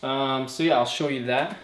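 ordinary delivery

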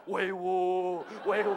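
long, low drawn-out chant; mock guard shout. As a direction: imitate palace guards calling out in ceremony. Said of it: "大喊". (模仿卫兵低沉拖长的喊威武)

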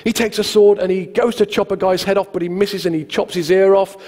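usual speaking voice

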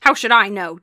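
loud, testy retort; angry snap. A narrator's reading. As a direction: snap it back at your wife. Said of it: "Dad snapped angrily".

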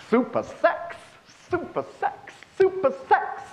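put-on high, breathy sing-song of an old lady; giddy old lady. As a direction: chirp it out high like a giddy grandma. Old lady sings out in hall.

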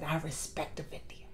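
breathy hushed whisper of the line; whispered quote. Retold or imitated. Vlogger savoring the queen's words, whispered in awe. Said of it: "what I love when she said".

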